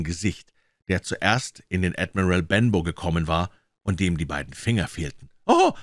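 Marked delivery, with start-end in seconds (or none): 5.5-5.7 high, loud cry of alarm; aufgeregter Ausruf. A narrator's reading. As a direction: call out high in surprise. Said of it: "rief ich". (hoher, erschrockener Ausruf)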